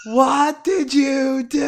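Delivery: drawn-out, high, loud mock yell; imitated yelling. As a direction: stretch each word into an appalled shout. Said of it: "the line and him yelling".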